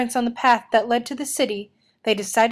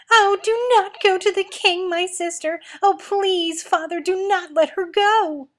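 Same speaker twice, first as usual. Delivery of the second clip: hysterical sobbing plea; 9 semitones higher than usual. A narrator's reading. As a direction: beg desperately through sobs. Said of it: "she cried hysterically".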